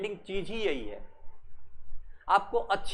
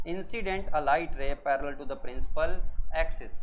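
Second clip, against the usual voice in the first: slow, word by word for notes; slow dictation; 3 semitones lower, much slower than usual. Shown as a demonstration. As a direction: dictate it slowly so students can write. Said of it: "क्या बोलेंगे?". (धीरे धीरे लिखवाना)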